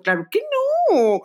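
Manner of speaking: high, slow, drawn-out mock protest; indignant denial. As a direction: stretch it out, high and offended. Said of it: "Y yo".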